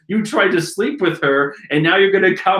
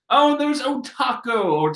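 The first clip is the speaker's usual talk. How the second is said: ordinary delivery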